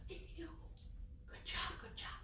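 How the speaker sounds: soft whispered praise. Real, not acted. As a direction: whisper encouragement.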